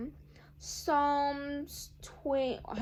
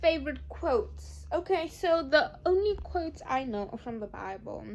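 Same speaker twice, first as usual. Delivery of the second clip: ordinary talk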